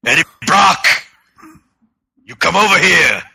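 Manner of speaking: high, loud growling barbarian. Acted. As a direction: bellow it like a brutish barbarian. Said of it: "the Barbarian voice being used for Venom".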